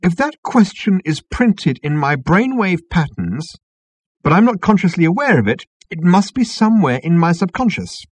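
put-on earnest young man, a bit keyed up; Arthur voice. A narrator's reading. Arthur explains eagerly.

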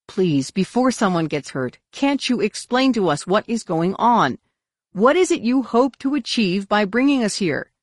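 urgent plea. A narrator's reading. Asking Senshi what is going on, urgent plea. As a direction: plead for answers with rising intensity. Pleading for an explanation.

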